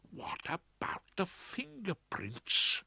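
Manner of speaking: urgent hissed whisper. A narrator's reading. Barrister hissing at his opponent in court, whispered. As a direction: hiss it across to your opponent. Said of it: "hissing at my so-called opponent".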